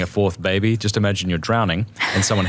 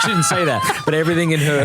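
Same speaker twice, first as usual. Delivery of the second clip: laughing; 8 semitones higher than usual. Real, not acted. Laughing at his own story.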